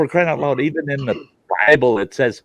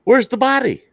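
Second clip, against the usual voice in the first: mock innocent question; 12 semitones higher, 4 dB louder than usual. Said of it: "And I go".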